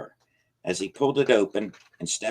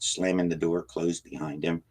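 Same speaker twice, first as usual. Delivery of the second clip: usual speaking voice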